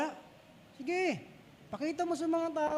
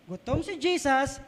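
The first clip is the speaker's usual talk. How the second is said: ordinary talk, nothing marked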